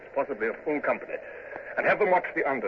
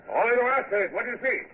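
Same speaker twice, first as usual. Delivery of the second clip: high hail to the masthead; calling up; 13 semitones higher than usual. Acted. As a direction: shout the question up the mast. Captain hailing lookout.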